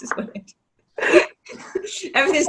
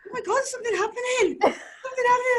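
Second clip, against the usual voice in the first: high, hammed-up alarm with a laugh; mock alarm; 3 dB quieter than usual. Newcomer stepping off the train in London, mock alarm. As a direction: play up the panic at the station. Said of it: "I was like".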